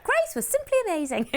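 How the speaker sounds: squeaky mock-customer voice of praise; voicing Samantha. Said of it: "[name], you do Samantha".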